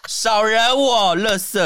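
slow, high hammed-up threat; mock tough talk. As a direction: play a fake tough guy. Mock tough-guy line, laughing. (假装凶狠地慢慢说)

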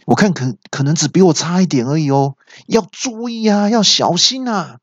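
high-pitched, anxious calling out; urgent warning cry. A narrator's reading. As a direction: call an urgent warning to your brother. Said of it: "急忙大喊". (急忙高声示警)

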